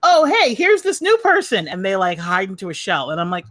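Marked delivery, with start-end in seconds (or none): 0.0-1.7 high, chirpy social greeting; acted-out eager hello; perky introduction. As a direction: introduce a newcomer brightly, pitched up. Acting out introducing a friend.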